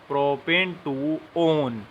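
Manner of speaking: Propane टू ओन drawn out word by word; slow, careful teacher dictation. Shown as a demonstration. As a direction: dictate propane टू ओन slowly so they can write. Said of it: "इसको वन मत पढ़ना".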